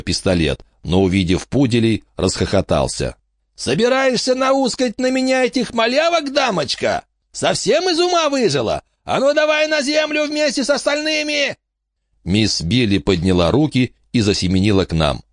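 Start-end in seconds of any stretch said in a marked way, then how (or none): 3.5-11.6 high, sneering thug voice; mock the crazy old lady. Bandit mocks old lady.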